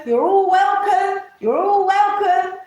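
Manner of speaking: sing-song, mocking welcome. Mocking a group's fake welcome in a sing-song voice. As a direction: sing it sweetly to mock their fake friendliness.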